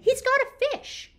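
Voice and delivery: excited shout. Acted. Simon shouts about the eagle's catch, excited. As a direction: shout it out, thrilled. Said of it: "cried Simon".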